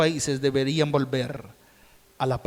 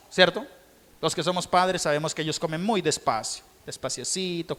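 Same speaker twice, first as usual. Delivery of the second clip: normal talking voice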